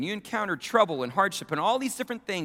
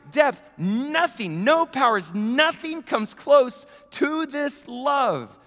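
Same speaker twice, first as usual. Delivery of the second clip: louder, higher, building sermon climax; preaching at full force; 8 semitones higher, 4 dB louder than usual. Acted. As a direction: preach it like a blowout victory.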